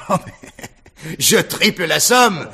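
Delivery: laughing bid. Acted.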